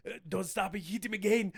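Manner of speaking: rough imitation of an action star's accent; loose, hammy movie-star impression; Jean-Claude Van Damme. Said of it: "my Jean-Claude Van Damme impression".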